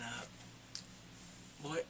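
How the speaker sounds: ordinary talk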